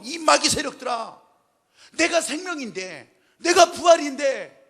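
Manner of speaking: loud, slow rebuke to the devil's forces; wrathful proclamation. Retold or imitated. Jesus rebuking the devil's forces, slow loud fury. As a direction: thunder it out, slow and commanding. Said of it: "진노하시면서".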